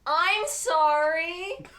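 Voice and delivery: mean the sorry; sincere apology. Shown as a demonstration. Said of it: "Like you mean it".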